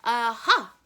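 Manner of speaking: put-on a baby crowing aha at the villain; triumphant cry. A narrator's reading. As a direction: crow it in triumph. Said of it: "Sunny cried again".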